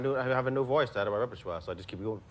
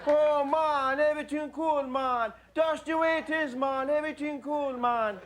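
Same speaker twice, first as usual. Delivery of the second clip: high, loud mock Jamaican accent; 4 dB louder, slower than usual. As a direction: belt out a comic Jamaican accent. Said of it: "If Jamaica comes in the car".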